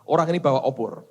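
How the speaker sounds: ordinary delivery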